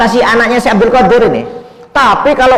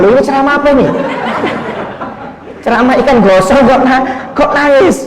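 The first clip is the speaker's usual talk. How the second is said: high-pitched, incredulous questioning; baffled, raised-pitch retelling; bewildered son; 7 semitones higher than usual. Retold or imitated. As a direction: act out a son who can't make sense of it. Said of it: "Si anak bingung".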